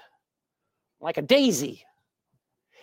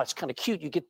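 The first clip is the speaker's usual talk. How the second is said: usual speaking voice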